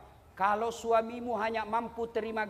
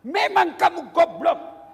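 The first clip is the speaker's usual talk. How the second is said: slow, loud insult with a laugh in it; insulting wife; 5 semitones higher, 10 dB louder, slower than usual. Acted. Wife insults her husband, loud mock anger. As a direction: spit out the insult slowly. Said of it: "maki-maki, menghina suami".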